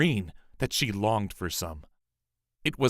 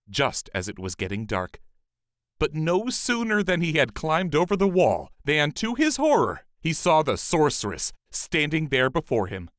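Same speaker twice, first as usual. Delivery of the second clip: ordinary delivery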